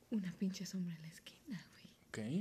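hushed, breathy telling of the shadow; spooky whisper. Retold or imitated. Telling of the shadow in the corner, spooky whisper. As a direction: drop to a whisper for the scare. Describing the shadow she saw.

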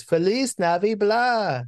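high, drawn-out botched greeting; Pee Wee Herman. Retold or imitated. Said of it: "Like, he can't get it right".

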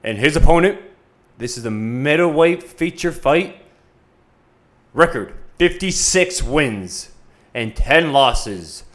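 drawn-out fight introduction; boxing ring announcer. Acted. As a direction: build to the name, slowly.

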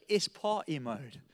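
put-on slow, nonchalant British accent; unbothered British driver accent, drawn out; mocking British accent. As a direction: drawl it coolly in a British accent. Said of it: "He makes a complete mockery".

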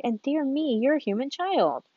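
a hint of joyful surprise; delighted. A narrator's reading. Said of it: "it cried out with a voice of delight".

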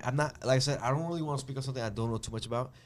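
normal talking voice

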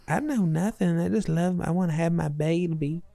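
bad Southern accent. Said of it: "very fun Southern California version of the Southern accent".

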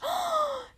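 high, drawn-in gasp of mock surprise; dramatic gasp. History teller hails a returning character, dramatic gasp. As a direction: gasp for effect as a familiar name returns.

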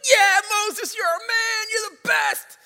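high, loud hammed-up cheering shout; crowd cheering. Preacher voicing Israelites cheering after the sea parts, high loud mock cheer. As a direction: whoop for Moses like fans. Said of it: "the people are like".

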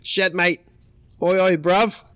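mock Aussie surfer; Australian accent. Storyteller mimicking Australian surfers talking trash, mock Australian accent. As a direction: imitate Australian trash talk. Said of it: "talking all that Australian shit".

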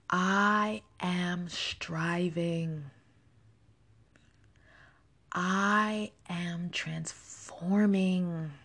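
slow, calm affirmation read, each line left to settle. Acted. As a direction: let every affirmation land slowly.

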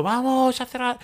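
a hammed-up TV shout; mock pundit shout. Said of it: "escucharle gritando".

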